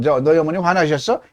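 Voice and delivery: usual speaking voice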